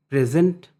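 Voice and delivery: Present said loud with the stress on the first syllable, PRE-zent. Shown as a demonstration. Teacher showing students the noun stress, PRE-zent loud and firm. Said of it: "present का मतलब यहाँ पे noun".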